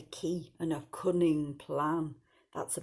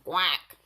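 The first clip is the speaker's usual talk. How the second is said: loud single quack; duck quack; 6 dB louder than usual. A narrator's reading. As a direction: imitate a duck with one quick quack. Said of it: "said the duck".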